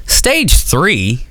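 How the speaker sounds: high, cheery consoling voice; pep her up with a bright joke; mock comforting quip. To his sick cousin, a high chirpy mock reassurance.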